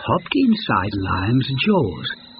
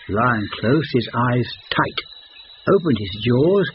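ordinary delivery, nothing marked

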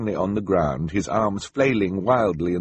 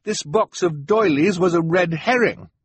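put-on high, loud boy's outburst; crying out; 10 semitones higher, 5 dB louder than usual. A narrator's reading. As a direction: protest loudly in a high voice. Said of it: "Klaus cried".

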